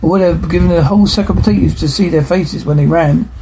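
usual speaking voice

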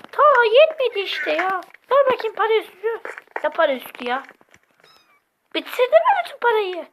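high, loud angry scolding. Pretend. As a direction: scold him for spending it all. Character scolding about money.

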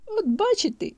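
usual speaking voice

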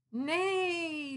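a cat pretending to be a unicorn; kitty neigh. Kitty neighs at her friends, high pretend neigh.

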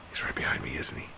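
whispering, barely voiced; hushed whisper. Real, not acted. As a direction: breathe it so he can't hear. Enemy right behind, hushed.

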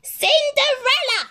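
very high, loud call; calling from downstairs. A narrator's reading. Stepmother calls Cinderella from downstairs, loud high call. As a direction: shout her name up the stairs.

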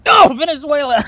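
high loud laugh at own mistake; high laughing groan; laughing exasperation. Real, not acted. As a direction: cackle at getting it wrong. Reacting to wrong answer.

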